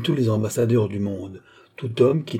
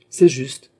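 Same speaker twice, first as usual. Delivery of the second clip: dignified; 3 semitones higher, 6 dB louder than usual. A narrator's reading. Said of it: "fit Don Manoel avec dignité".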